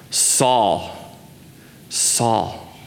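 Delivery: low, slow, grave calling of the name; deep emotion. Acted. God calls Saul's name twice from heaven, with deep emotion. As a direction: call the name low and unhurried, with deep feeling. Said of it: "it's to display deep emotion".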